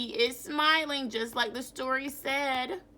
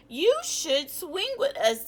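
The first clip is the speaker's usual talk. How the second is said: higher, louder invitation; monkey coaxing the grumpy chimp, pitched up; playful monkey; 8 semitones higher, 5 dB louder than usual. Acted. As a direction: bounce it like an eager monkey.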